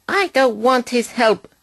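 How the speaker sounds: high, cross snap; snapping. A narrator's reading. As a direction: bite the words off, high and cross. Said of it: "snapped Mavis".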